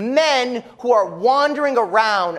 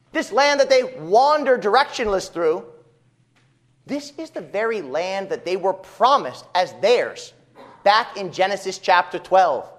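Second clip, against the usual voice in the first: usual speaking voice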